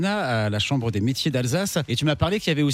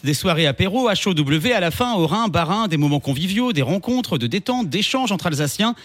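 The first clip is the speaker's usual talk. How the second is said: radio announcer; 4 semitones higher than usual. Acted. Host relaunches the show after the song, announcer style. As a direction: sell the playlist and the guest like a DJ.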